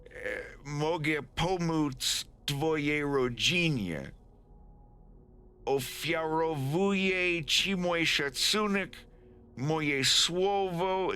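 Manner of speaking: halting read-aloud in anglicized Polish; nervous appeal. Acted. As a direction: deliver a careful formal plea, word by word. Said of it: "Wolf swallows, takes a breath".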